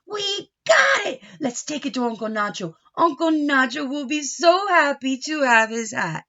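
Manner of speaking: loud and high, thrilled; triumphant shout. Acted. Boys shout to each other, triumphant. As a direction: cheer it out, proud of the win.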